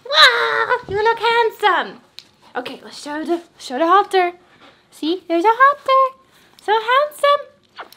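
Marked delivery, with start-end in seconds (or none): 0.1-2.0 excited dog praise. Real, not acted. Gushing over dog in collar. 4.9-7.9 squeaky praising a dog. Real, not acted. Showing off dog's halter.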